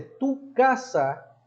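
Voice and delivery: "tu casa" alto y muy lento; tu casa marcado. Shown as a demonstration. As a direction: recalca "tu casa" despacio y agudo. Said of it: "es un aspecto también de posesión". ("tu casa" subrayado, lento y alto)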